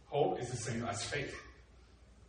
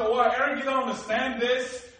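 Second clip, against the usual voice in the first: exasperated officer; 8 semitones higher, 11 dB louder than usual. Retold or imitated. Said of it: "she said".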